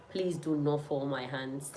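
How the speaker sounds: quiet, slow plea; soft pleading. Real, not acted. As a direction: softly beg them not to let you down. Begging viewers for answers.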